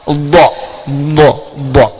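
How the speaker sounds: dha with the heavy Arabic dad; Arabic dad sound. Shown as a demonstration. Teacher drills the letter unique to Arabic, Arabic dad. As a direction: repeat dha, dha, dha with the dad from the tongue's side. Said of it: "yaitu huruf dha".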